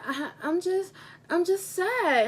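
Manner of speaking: half-acted whimper of a sad boy; imitating a sad child. Teacher voicing her crying student, mock tearful.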